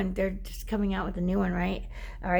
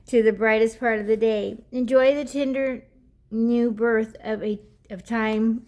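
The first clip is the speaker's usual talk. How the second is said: lightly lifted, slightly louder reading voice; card reading to viewers; oracle card reading; 5 dB louder than usual. Acted. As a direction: read the guidebook aloud like a gentle message. Reads the oracle card text.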